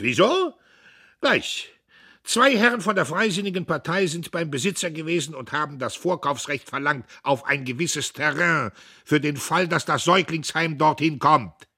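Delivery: loud, high speech; loud, high oration; bellowing orator. A narrator's reading. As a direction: harangue the hall. Diederich harangues.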